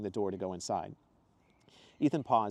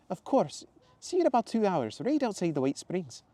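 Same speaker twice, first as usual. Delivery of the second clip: much higher, louder cheerful woman's voice; Zoe's exclamation; 10 semitones higher, 4 dB louder than usual. Acted. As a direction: greet him brightly and high. Zoe voiced.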